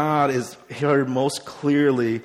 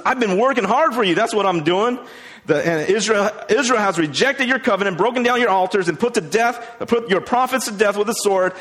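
high, loud aggrieved complaint; self-pitying protest; 8 semitones higher, 5 dB louder than usual. Acted. Elijah complains to God of being left alone, aggrieved. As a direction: protest your hard work, raised voice. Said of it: "he's getting all, you know, "Oh, pity me".

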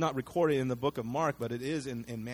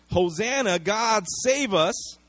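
louder, slow drawn-out acclaim; crowd shouting; 4 semitones higher, 6 dB louder, slower than usual. Retold or imitated. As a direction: call it out like a cheering crowd. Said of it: "same people who are shouting".

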